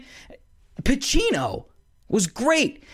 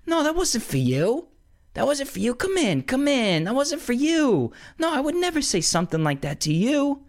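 much higher, soothing Pacino plea; Pacino calming down; 13 semitones higher than usual. Retold or imitated. Pacino's character smoothing things over with De Niro, coaxing. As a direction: coax him back in a high placating voice. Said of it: "he brought it down to three".

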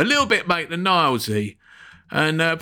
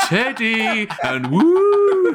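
ghost noises; 9 semitones higher, 5 dB louder, much slower than usual. Retold or imitated. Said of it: "we started making ghost noises".

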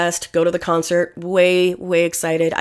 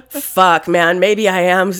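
normal talking voice

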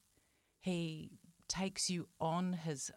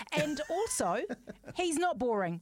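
higher, louder, bubbling with excitement; excited; 4 semitones higher, 4 dB louder than usual. Real, not acted. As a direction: hype him up with glee. Hyping up guest, laughing.

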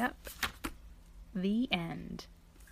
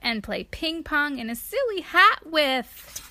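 higher and louder, building complaint; wailing question; 6 semitones higher, 6 dB louder than usual. A narrator's reading. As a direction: pile it all into one wail. Elephant's final wailing question.

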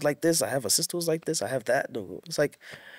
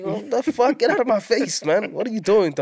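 mock outraged reaction; 9 semitones higher, 4 dB louder than usual. Retold reaction to camera intrusion.

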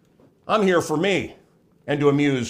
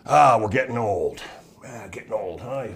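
mock weary complaint; weary aging friends; 6 semitones lower than usual. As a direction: groan it like a worn-out old friend, trailing away. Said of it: "I get from friends of mine, my contemporaries".

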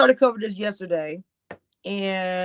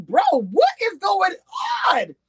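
retold disbelief; 12 semitones higher than usual. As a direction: act stunned and lost. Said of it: "I was like".

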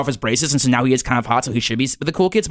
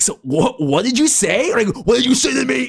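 rising, higher angry challenge; incensed Cooper; 10 semitones higher than usual. Retold or imitated. Angry Cooper confronting Garrett at the lunch table. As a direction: demand it, getting hotter and higher. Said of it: "Cooper is, like, incensed".

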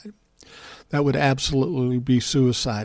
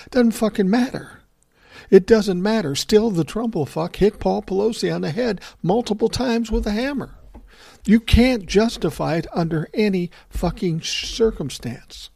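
worked up; 8 semitones higher than usual. Real, not acted. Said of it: "I'm getting a little worked up".

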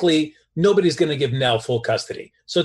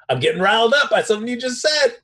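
mock riled up; 6 semitones higher than usual. Acts out Carly getting riled up.

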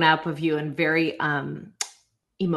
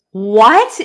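a loud, high, startled "What?"; stunned what; 4 semitones higher, 12 dB louder than usual. Real, not acted. Host cuts in, shocked by the reskate story, loud startled what. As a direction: blurt it out in disbelief.

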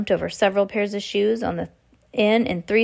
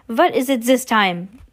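slow, raised, exasperated witch; loud drawn-out demand, higher in pitch; witch shouting; 4 semitones higher, 5 dB louder than usual. A narrator's reading. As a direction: call out slowly, irritated. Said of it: "the Grand High Witch shouted".

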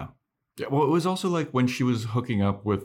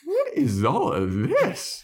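high, drawn-out incredulous squeal; hammed-up "what on earth" reaction; exasperated disbelief; 4 dB louder, slower than usual. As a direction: squeal it in exaggerated bafflement. Teller's exasperated reaction to the scene.

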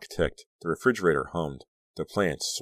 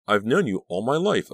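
higher, louder insistent protest; shocked protest; 6 semitones higher, 5 dB louder than usual. A narrator's reading. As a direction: protest in disbelief, voice raised. Continues shrieked protest.

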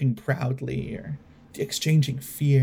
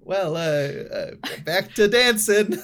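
flustered; 6 semitones higher, 4 dB louder than usual. Acted. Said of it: "a little sort of stunned".

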